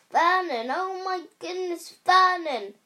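high, loud cry of alarm; panicked cry. Acted. Aunt cries out to her husband, loud and panicked. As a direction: call to him in panic.

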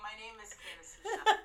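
giggling through her name; laughing. Real, not acted. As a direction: try not to crack up. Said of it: "Sorry, edit that out".

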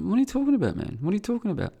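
high-pitched mocking disbelief; open mockery. Laughing off a lefty Israeli's boasts, high mocking. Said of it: "I just started mocking him openly".

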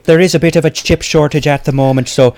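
earnest Irish brogue; Irish accent. Acted. Sculptor excusing unfinished work to hosts, Irish accent. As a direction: explain it as a polite Irishman. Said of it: "It is an Irish accent".

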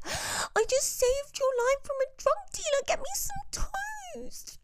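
very high, indignant demand; mock indignant friend ordering toast; bossy hungover friend. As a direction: squeak out the demand like a diva. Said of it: "And Maddie's like".

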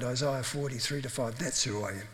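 ordinary talk

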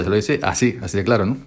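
ordinary talk

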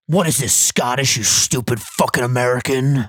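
lightly voiced sneering commenter; a hint of a sneering troll. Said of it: "take it off on two schmucks".

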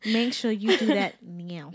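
drawn-out bossy repeat; slow hammed-up order to viewers; slow mock command. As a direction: lean on each word slowly. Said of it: "repeats plug slower".

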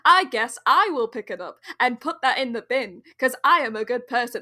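showily martyred call-out; passive-aggressive. Acted. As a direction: make a pointed song and dance of it. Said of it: "in a very, very passive-aggressive way".

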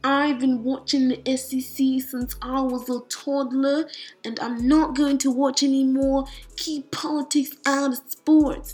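mock an upset fan with a Southern accent you can't really do; lightly imitated angry Southern fan, accent barely there; failed Southern accent. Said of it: "That's not even a Southern accent".

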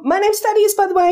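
high, eager little voice; awkward belated introduction. Acted. New starter remembering his manners as she leaves, high and awkward. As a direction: pipe it up as an afterthought. Newcomer calls after departing colleague.

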